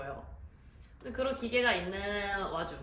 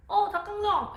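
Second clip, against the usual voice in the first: high, louder startled exclamation; acted-out yell, pitched way up; imitated cry of alarm; 12 semitones higher, 6 dB louder than usual. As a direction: mimic crying out in sudden shock. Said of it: "이런 소리를 질러".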